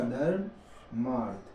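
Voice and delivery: धर्मार्थ drawn out syllable by syllable, slow and soft; slow spelled-out word. Shown as a demonstration. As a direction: stretch धर्मार्थ slowly as if writing it on the board. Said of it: "क्या बन जाएगा?".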